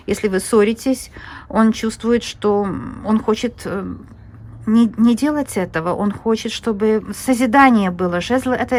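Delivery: usual speaking voice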